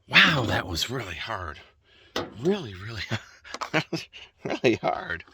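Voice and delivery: huffing and puffing after hard work; winded, catching breath between words. Real, not acted. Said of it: "I apologize when I'm huffing and puffing".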